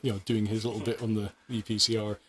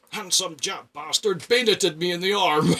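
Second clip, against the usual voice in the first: put-on booming old veteran; 8 semitones higher, 7 dB louder than usual. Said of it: "voice from the floor went".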